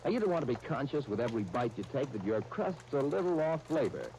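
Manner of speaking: ordinary talk, nothing marked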